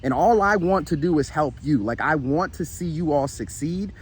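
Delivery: normal talking voice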